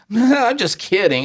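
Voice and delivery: high, goofy mock backpedal; goofy joking. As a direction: brush it off in a silly high voice. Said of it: "in a goofy way".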